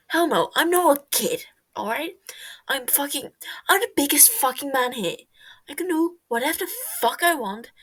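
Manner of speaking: loud, high, indignant bragging; defiant shout. Acted. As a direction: shout it, proving you're grown. Tommy defiant, shouting he's not a kid.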